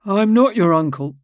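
a priest setting the record straight; indignant priest. Acted. Ambrosio corrects her.

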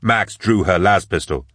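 ordinary delivery

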